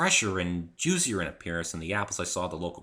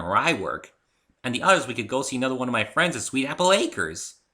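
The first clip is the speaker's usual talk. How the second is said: higher and louder, bouncy and eager; Pinky voice; 6 semitones higher, 8 dB louder than usual. A narrator's reading. Pinky cheerfully offers the hungry narrator two places to eat. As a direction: babble happily at a hungry friend.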